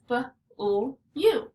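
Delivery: B-L-U as three separated sounds; sounding out. Shown as a demonstration. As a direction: break blew into "B", "L", long "U". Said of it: "we can sound it out".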